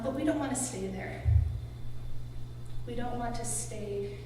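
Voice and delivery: usual speaking voice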